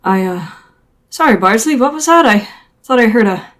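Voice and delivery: a lightly voiced, dazed wounded sailor; dazed, confused. Injured Billy rambling to Liam, mildly dazed and confused. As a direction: drift in and out, lost. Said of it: "he's kind of stuck in something".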